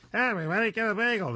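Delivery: high crooner voice, a put-on old star. Host showing off his crooner impression to the crew. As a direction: croon it like an old-time singer. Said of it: "my [name] impression".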